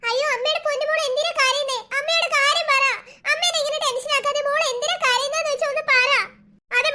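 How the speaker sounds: put-on high, fretting mother's voice coaxing her child; anxious mother. Mother begs her crying daughter to speak, high and fretful. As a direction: plead like a worried mom.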